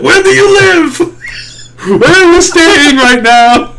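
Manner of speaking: high, drawn-out mock interrogation; aggressive questioning. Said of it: "that is aggressive".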